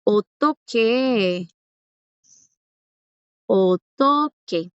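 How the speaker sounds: slow, careful "Otoque", each syllable drawn out; teacher models "Otoque" slowly for students; slow Korean word demo. Shown as a demonstration. Teacher drilling students, slow syllable-by-syllable model. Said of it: "los que son de ver dramas han escuchado".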